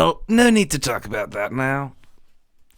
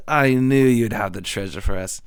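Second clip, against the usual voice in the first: ordinary delivery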